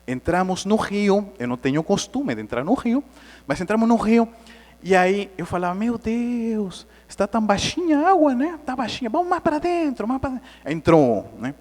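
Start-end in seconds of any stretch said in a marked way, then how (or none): 5.7-10.5 high, light retold chatter; egging the others on in a high voice; retold eager exclamation. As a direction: mimic eager wonder at the shallow water. Retold naive excitement entering river.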